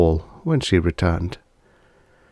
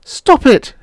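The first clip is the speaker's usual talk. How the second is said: higher, louder anguished cry; wife crying out at her husband; cried out; 7 dB louder than usual. Acted. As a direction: cry out to make him stop. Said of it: "she cried".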